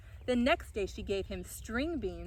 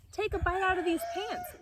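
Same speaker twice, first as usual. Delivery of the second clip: higher, louder coaxing father goat; 11 semitones higher, 5 dB louder than usual. Acted. Father goat urges his son to eat, coaxing. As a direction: push the pants on him cheerfully.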